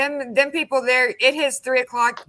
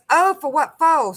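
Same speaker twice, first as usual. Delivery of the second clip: surprised Southern lady; 6 semitones higher, 7 dB louder, slower than usual. Acted. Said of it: "Southern ladies".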